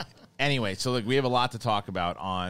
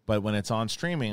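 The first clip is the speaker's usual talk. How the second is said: ordinary talk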